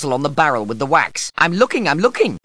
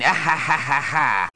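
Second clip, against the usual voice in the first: derisive "ha-ha-ha"; mocking laughter. Wizards go on laughing at the boy, mocking laughter. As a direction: keep laughing at him.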